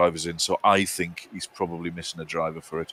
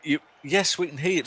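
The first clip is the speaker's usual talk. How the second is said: ordinary delivery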